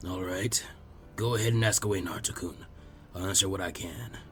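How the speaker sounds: put-on gentle, low old village elder; old Hokage. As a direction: speak like a patient elderly leader. Old Hiruzen voiced lower.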